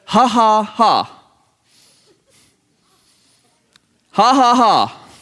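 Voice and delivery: deliberate mock laugh, syllable by syllable; demonstrated spoken laugh. Shown as a demonstration. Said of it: "the Hebrew's way of laughing".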